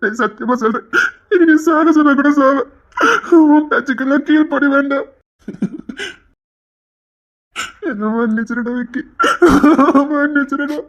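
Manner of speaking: sobbing vow of remorse; tearful pleading. Acted. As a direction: swear it between sobs. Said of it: "ஏன்டா அழுதுட்டு இருக்க".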